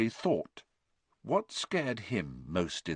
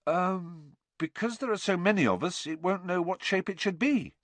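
put-on higher boyish voice, unsure; a boy guessing in class; hesitant schoolboy Harry; 10 semitones higher than usual. A narrator's reading.

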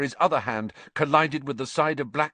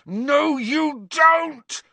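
much higher, louder roar; 7 dB louder, much slower than usual. A narrator's reading. Harry stopping Crookshanks from his wand, roar. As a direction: bellow to stop the cat. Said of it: "roared Harry".

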